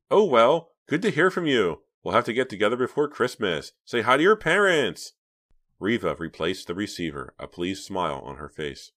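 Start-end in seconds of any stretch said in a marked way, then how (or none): 0.1-5.1 much higher, louder breezy cheer; brightened tone. A narrator's reading. Reva ending the call, suddenly bright and cheery. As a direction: switch to bright goodbye. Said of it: "she brightened her tone".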